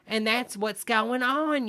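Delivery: usual speaking voice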